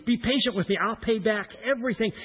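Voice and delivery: pleading high and loud for mercy; loud desperate plea. Retold or imitated. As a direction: beg as if on your knees. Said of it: "he pleads for mercy".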